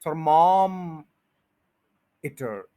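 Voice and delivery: Thermometer broken after a very long "ther-MAAAM", then short "iter"; stretched stressed syllable. Shown as a demonstration. Teacher slows the word for learners, stretched stress. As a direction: hold MAAM in thermometer very long. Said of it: "इस पे stress करना है".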